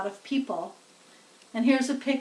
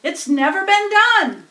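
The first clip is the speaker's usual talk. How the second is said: higher, louder announcer question; loudspeaker asking; 8 semitones higher, 9 dB louder than usual. A narrator's reading. Loudspeaker asks crowd about fainted pig, alarmed announcer. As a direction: call out a hint of alarm. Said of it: "said the loudspeaker".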